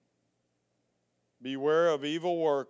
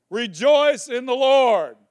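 loud, higher, slow proclamation; 9 semitones higher, 11 dB louder than usual. Real, not acted. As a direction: ring it out over the congregation. Preacher proclaiming the verse.

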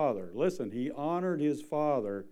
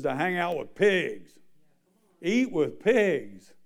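preaching rebuke; 3 semitones higher, 4 dB louder than usual. Real, not acted.

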